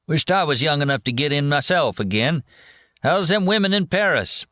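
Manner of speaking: hint of a back-slapping old soldier; old vet. A narrator's reading.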